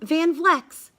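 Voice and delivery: ordinary delivery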